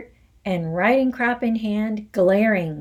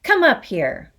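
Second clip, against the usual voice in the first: loud, higher shouted order; 5 semitones higher, 6 dB louder than usual. Acted. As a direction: shout the boy up to the stage. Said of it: "the Trunchbull shouted".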